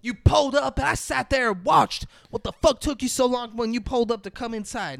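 high, strained mock scream; retold screaming. Woman at the stairs yells down at man arriving, screaming. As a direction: yell it out high, imitating a furious outburst. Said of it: "screaming at him".

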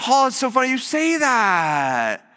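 high, drawn-out mock delight; put-on singsong eagerness; over-eager pitch. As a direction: gush it slowly, hammed-up. Acting over-eager pastor pouncing on opening.

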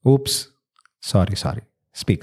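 usual speaking voice

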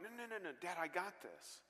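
put-on a boy jumping up to insist he can do it; eager protest. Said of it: "I'm like".

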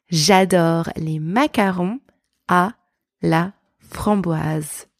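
French sentence broken up with pauses; careful, slow, word-group by word-group French; slow practice repeat. Shown as a demonstration. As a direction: draw out each piece for learners to copy. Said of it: "Let's practice together".

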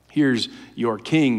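low, slow, soft mocking sneer. Pilate taunting the crowd about Jesus, quiet slow mockery. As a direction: hand it over quietly as a taunt. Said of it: "he says mockingly".

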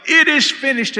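high, loud triumphant yell; shouted proclamation. Retold or imitated. Man on Jerusalem's walls yelling to the crowds, triumphant shout. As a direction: announce it to the waiting crowd. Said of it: "and he would yell".

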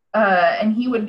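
ordinary talk, nothing marked

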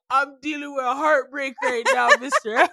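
high, loud mock protest with laughter in it; heartbroken student. Teacher imitating a student excusing hallway singing, melodramatic protest.

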